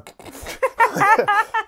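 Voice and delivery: loud, high burst of laughter. Real, not acted. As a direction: let out a big laugh. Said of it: "I just laughed".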